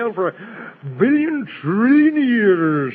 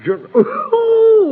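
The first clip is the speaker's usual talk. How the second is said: bursting into laughter; 5 dB louder than usual. Acted. Laughs at the name Jonathan.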